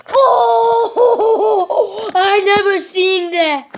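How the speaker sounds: laughing while talking; slow, laughing through words. Real, not acted. Laughing at cartoon.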